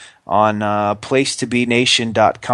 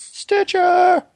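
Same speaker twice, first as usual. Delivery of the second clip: falsetto. Pretend. Mimicking her plug in falsetto.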